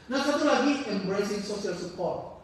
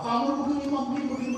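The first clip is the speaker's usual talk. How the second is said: higher, louder retold rant; mock scolding; 7 semitones higher, 4 dB louder, much faster than usual. Preacher recalling the youth's angry tirade, mock rant. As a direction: echo the young man's tirade.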